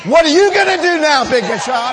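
high, very loud preacher's taunt; defiant shout. Acted. Believer throwing the question back at the devil, defiant shout. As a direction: holler the challenge at full force.

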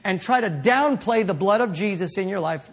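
usual speaking voice

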